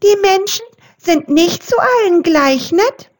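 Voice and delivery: wary little mouse, voiced high; squeaking mouse. A narrator's reading. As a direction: squeak it out fast like a tiny mouse. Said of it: "piepste Rudi Waldmaus".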